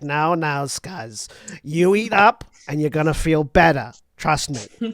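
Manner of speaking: consoling Jersey mom voice; Boston Jersey mom. Acted. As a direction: comfort a crying child in a Boston-Jersey accent. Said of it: "Boston Jersey mom".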